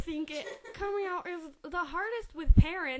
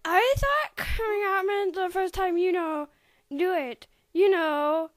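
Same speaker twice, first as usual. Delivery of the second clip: embarrassed blurt; 7 dB louder than usual. Acted.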